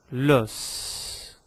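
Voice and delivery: "Los" ending in a drawn-out s; S final prononcé. Shown as a demonstration. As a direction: sound the last s of "Los" fully. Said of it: "pronuncio o S da palavra osso".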